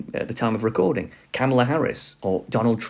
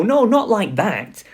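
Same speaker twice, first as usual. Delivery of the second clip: mock dismay; 8 semitones higher, 3 dB louder, slower than usual. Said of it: "I see things like this and I go".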